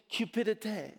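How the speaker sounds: "cupidité" in French, ending on a stressed "tay"; French accent. Shown as a demonstration. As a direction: say "cupidité" as a demonstrated French word. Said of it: "related to another French word".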